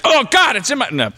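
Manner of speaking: real shock at a stink; disgusted surprise. Real, not acted. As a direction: recoil at a bad smell. Finding the fart smell.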